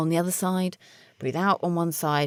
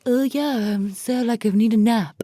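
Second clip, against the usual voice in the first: sleepy mock voice; 6 semitones higher than usual. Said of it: "without making you like".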